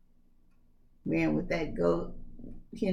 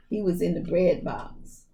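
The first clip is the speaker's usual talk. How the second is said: plain reading voice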